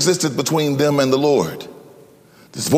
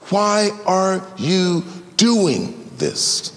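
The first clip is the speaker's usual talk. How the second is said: gentle question; 2 semitones higher, much slower than usual. Acted. Jesus asking Saul for reflection, slow and gentle. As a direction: ask it slowly, inviting reflection.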